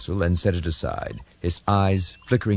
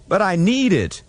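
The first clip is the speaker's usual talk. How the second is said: insistent ensign; 13 semitones higher, 5 dB louder than usual. Acted.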